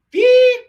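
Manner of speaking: very high, loud spoken bleep; piercing mouth-made bleep; imitated censor beep. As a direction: squeal a single beep like a censor tone. Imitated censor bleep after acted retort.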